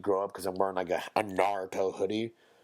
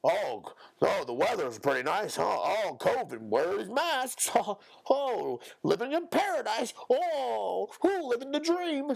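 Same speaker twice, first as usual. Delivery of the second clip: high, chirpy mock voice with laughs; mocking small-talker; 10 semitones higher than usual. Mocking empty small talk about weather, very high. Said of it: "nothing unique to say".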